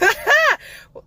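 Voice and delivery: a burst of high delighted laughter. Real, not acted. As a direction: laugh out loud at the good news.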